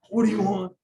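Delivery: high, put-upon "What do you want?"; mock annoyed kid. Son whining back at his dad, mock annoyed. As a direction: whine it like a bothered teenager. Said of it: "If I say it a certain way".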